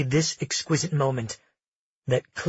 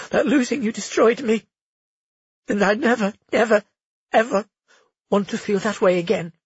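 put-on higher, louder, impassioned young woman; pleading, leaning on never; heroine voice; 8 semitones higher, 4 dB louder than usual. Heroine's confession continues.

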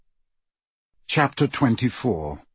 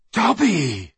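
higher, urgent cry of a name; alarmed call; 7 semitones higher, 3 dB louder than usual. A narrator's reading. Harry seeing the little elf sway, alarmed.

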